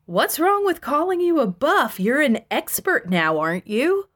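high-pitched disbelief, easing down; incredulous. A narrator's reading. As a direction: question them as if it's absurd. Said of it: "I replied incredulously".

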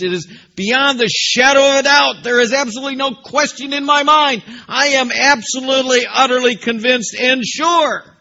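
fervent preacher voicing Paul's certainty; preaching at full force. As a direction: build an unshakable declaration. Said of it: "You see how emphatic he is?".

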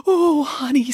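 high, longing exclamation; jealous. A narrator's reading. Boris craving the wolf's honey, jealous. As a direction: coo it with envy. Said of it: "said Boris jealously".

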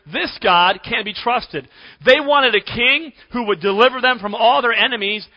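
usual speaking voice

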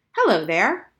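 loud, bright hello to a new patient; cheerful greeting. Acted. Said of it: "he said cheerfully".

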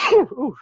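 a real sneeze, loud and high; sneezing. Real, not acted. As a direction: sneeze then a startled ooh. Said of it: "I'm gonna sneeze".

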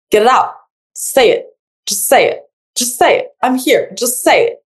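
حث خفيف مثل مدرب يشجع اللاعب; قلها فقط، بتشجيع مدرب خفيف; coaching partner. Retold or imitated. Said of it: "just like the coach".

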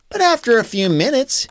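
usual speaking voice

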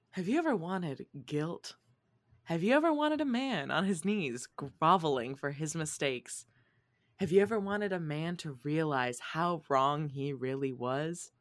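soft, lightly hammed infomercial pitch; mock sales pitch. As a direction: sell the books like a late-night ad, quietly.

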